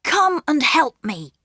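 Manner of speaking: put-on a keen young brother with a plan; boy Peter. A narrator's reading. Peter whispers a secret plan to his sisters, eager. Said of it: "Peter said to the girls".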